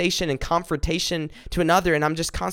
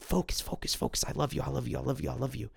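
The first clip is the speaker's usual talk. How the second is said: a hushed whisper, much softer; 10 dB quieter, faster than usual. Retold or imitated. God speaking tenderly to Jonah, in a whisper. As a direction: breathe the words like a secret.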